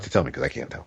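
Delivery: hushed aside; quick whisper. Real, not acted. Asks listener quietly about connection.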